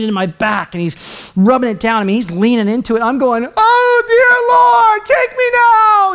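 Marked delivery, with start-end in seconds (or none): 3.6-6.1 high, loud and slow crying shout; hammed-up wail of agony. Preacher reliving the pain stick, wailing to the Lord in mock agony. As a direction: stretch out a pleading howl, like it's the end.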